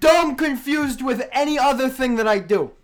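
half-playing the character; Dome character. Host explaining Dome to friends, lightly in character. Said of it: "Not to be confused with Dome".

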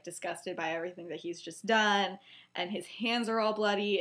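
usual speaking voice

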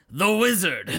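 mock-dramatic quote; imitating barbarian line. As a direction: pitch it up like a barbarian's one thought. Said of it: "are just the words".

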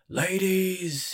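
high, drawn-out showman's call; ringmaster's announcement; booming. A narrator's reading. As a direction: open the show grandly, stretching the word. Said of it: "he boomed".

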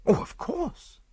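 high-pitched, eager agreement. A narrator's reading. Friend agrees to do a service, eager and high. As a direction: agree readily, pitch jumping up. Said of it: "I said eagerly".

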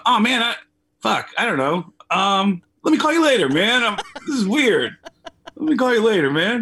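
awkward and weird. Retold or imitated. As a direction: squirm your way out of the call. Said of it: "I just felt weird".

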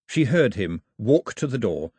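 usual speaking voice

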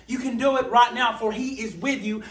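fiery revival preaching; preaching at full force. Acted. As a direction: preach it out with rising fervor.

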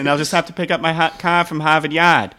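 loud, hammed-up Boston accent; brash Boston local ordering Dunkin', hammed-up Boston accent. Playing a Boston local for friends, loud Boston accent. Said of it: "That is his best accent".

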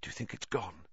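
very soft, cautious question; whispered. A narrator's reading. Harry checking whether the danger has passed, whispered. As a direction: ask it barely aloud, afraid to be heard. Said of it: "Harry whispered".

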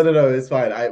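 usual speaking voice

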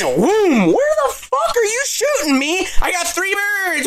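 high, laughing whoop of triumph; gleeful shout. Retold or imitated. Hunter bragging mid-hunt about three birds, high laughing shout.

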